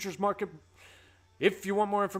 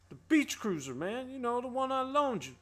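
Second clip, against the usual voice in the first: higher, pleading mock complaint; playful Friday movie imitation, higher; whiny Friday voice; 7 semitones higher than usual. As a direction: imitate a nervous friend asking for his bike. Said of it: "my best, uh, Friday impression".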